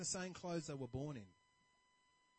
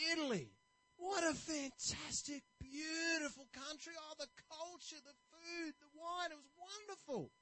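delighted traveler; 9 semitones higher than usual. Retold or imitated. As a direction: swoon over the memories. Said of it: "he's really happy".

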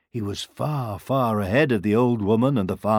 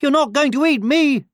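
high, loud gingerbread man voice; squeaky little character shouting; defiant cry; 5 dB louder than usual. A narrator's reading. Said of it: "he cried".

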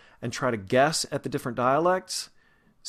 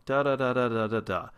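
da da da sliding down at the end; falling tune. Shown as a demonstration. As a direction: hum the standard American falling tune. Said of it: "most of our sentences go down".

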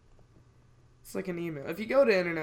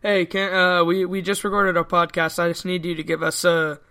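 loud into phone; 10 dB louder than usual. Real, not acted. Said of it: "What did you say?".

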